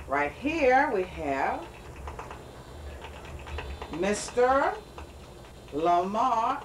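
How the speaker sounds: mock TV announcer. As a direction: build up the big reveal like a game-show host. Restarts mock TV host introduction.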